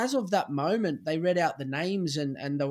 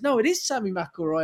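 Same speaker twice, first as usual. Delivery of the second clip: put-on Irish accent; 6 semitones higher, 4 dB louder than usual. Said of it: "it's like an Irishman".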